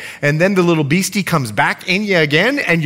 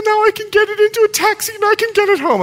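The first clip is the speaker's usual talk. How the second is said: much higher, tearful grateful woman's voice; 3 dB louder than usual. Retold or imitated. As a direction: play her thankful, close to tears. Grateful African woman voiced in story.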